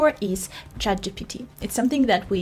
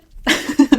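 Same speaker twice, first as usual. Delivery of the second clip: embarrassed breathy laugh; 10 dB louder than usual. Real, not acted. Laughs at own accent.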